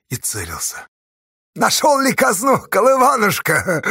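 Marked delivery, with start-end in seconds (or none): 1.5-3.8 high, loud call dripping with mock affection; loud singsong coaxing; sweet-talking shout. A narrator's reading. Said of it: "ласково крикнул Чупря".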